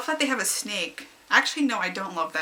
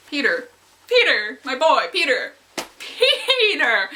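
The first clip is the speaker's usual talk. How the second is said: rising fangirl squeal; 5 semitones higher, 7 dB louder, slower than usual. Retold or imitated. Giggling alone at night over fic.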